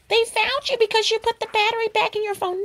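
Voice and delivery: high, sing-song voice mimicking doubters; mocking the viewers. Mimicking viewers who doubt her, in a high, mocking voice. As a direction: parrot the audience's know-it-all guess. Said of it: "you guys are thinking".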